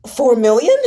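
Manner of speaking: loud, rising shock at the sum; stunned disbelief. Acted. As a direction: blurt the number out in disbelief. Shocked question at price.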